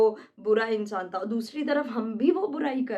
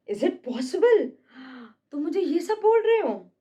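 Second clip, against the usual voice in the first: higher, louder disbelief; shocked disbelief; 4 semitones higher, 3 dB louder than usual. Acted. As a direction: act stunned at the insult. Cassius shocked at insult.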